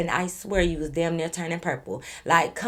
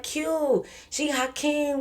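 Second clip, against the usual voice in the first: mock calling out; 7 semitones higher, 3 dB quieter, much slower than usual. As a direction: call their names in a raised pitch. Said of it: "we yelling their name".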